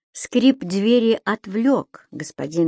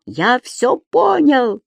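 slow, high exclamation; dawning cry; 6 semitones higher, 3 dB louder, much slower than usual. Acted. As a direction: shout it as it clicks. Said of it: "закричал Субастик".

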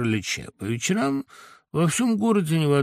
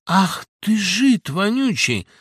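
высокий громкий злобный выкрик; выплюни оскорбление с ненавистью; злоба; 7 semitones higher, 5 dB louder, slower than usual. Acted. Гундосый оскорбляет Сашку. (злобная брань)

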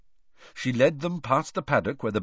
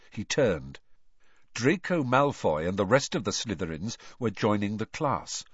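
ordinary delivery, nothing marked

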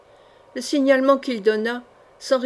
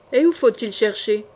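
ordinary delivery

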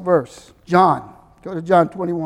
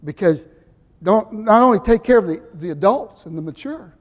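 normal talking voice

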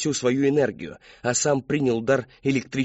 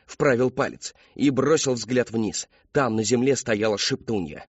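ordinary delivery

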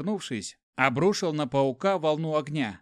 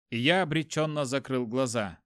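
usual speaking voice

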